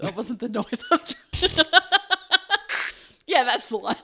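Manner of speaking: breaking up in high laughter; giggling at a failed sound effect; laughing. Real, not acted. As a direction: laugh through the words, can't stop. Laughing at own noise.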